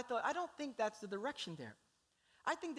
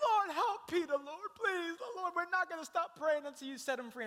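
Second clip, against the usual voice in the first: tearful pleading prayer; 9 semitones higher, 4 dB louder than usual. Acted. Church praying loudly for Peter.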